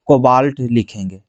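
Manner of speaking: low, drawn-out dictation of the term; slow dictation. Real, not acted. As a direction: stretch it out as you write. Dictating the term while writing it.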